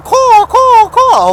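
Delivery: high, loud crow caws; crow cawing. Retold or imitated. Man at the graves imitates crows in the tree, high cawing. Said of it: "crows were up cawing. They're going".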